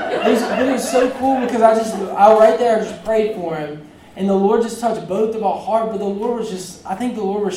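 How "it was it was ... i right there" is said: laughing joy. Real, not acted. Preacher laughing to the church about answered prayer. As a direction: let real delight spill out with a laugh. Joy recalling friend's tears.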